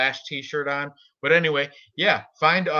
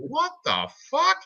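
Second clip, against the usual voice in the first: high squeaky disbelief, much higher; shocked disbelief; 14 semitones higher than usual. Retold or imitated. Telling a friend about spider webs, high shocked squeal. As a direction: recoil at the sight in mock horror. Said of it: "I'm like".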